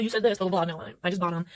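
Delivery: ordinary talk, nothing marked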